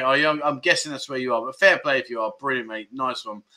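ordinary talk

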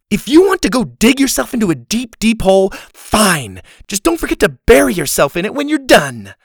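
loud, high, furious; angry shouting. A narrator's reading. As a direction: shout it down at him. Laney furious at Dylan.

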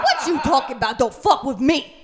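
lightly voiced movie tough guy; Samuel L. Jackson. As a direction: threaten like a Tarantino hitman. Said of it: "We're doing a Quentin film".

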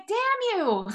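high-pitched mock outrage at someone else; retold fury. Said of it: "I was so angry and just furious".

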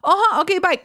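high chirpy hang-up; quick sweet goodbye. Retold or imitated. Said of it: "I'm just like".

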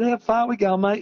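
called up high over the noise; calling out. Retold or imitated. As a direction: shout his mate's name up the ladder. Calling up the ladder to mate.

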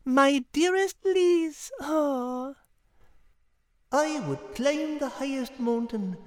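soft, high, moved reading of a love note; tender reading. Acted. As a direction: read it softly, touched. Liz reads the card.

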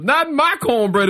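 high-pitched stage punchline; Adam's catchphrase. Retold or imitated. Storyteller quotes Adam on stage to the room, high catchphrase. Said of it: "And he goes".

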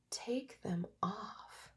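slow, hushed whisper of a gentle voice; a soft, slow divine whisper in her mind; whispered inner voice. Retold or imitated. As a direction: breathe it out slowly like a revelation. Words that came into her head.

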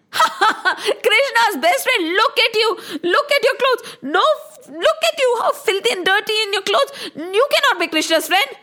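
high, scornful guard with a laugh; mocking laughter. Guards mock Sudama's claim.

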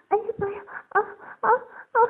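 very high, loud yelps; crying out. A narrator's reading. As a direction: yelp in sudden shock. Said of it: "鹏飞叫出声来".

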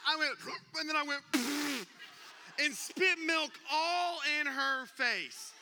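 high, loud, slow yelled retelling; frantic shouting. Retold or imitated. Dad retelling the late-night milk chaos, loud frantic yelling. Chaotic scene, everyone screaming.

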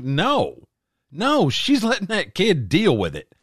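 high-pitched, insistent retort; emphatic disagreement. Retold or imitated. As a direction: push back hard on the objection.

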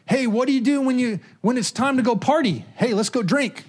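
high, eager party invitation. Retold or imitated. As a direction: sell the drinking like a fun idea. Party family voice, egging on.

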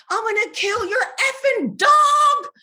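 high, loud yell of rage; furious retold threat. Re-enacting cursing out the dog's owners, furious high yell. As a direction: shriek it like an unhinged threat. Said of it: "I cursed them out".

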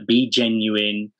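normal talking voice

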